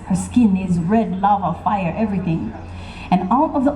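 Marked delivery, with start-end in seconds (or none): none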